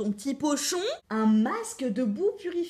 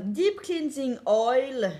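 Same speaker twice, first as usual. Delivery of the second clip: slow, showy English accent; crazy English accent; 4 dB louder, much slower than usual. Shown as a demonstration. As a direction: draw it out in a proudly overdone English accent. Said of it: "j'ai un accent anglais de fou". (accent anglais de fou, bien traînant)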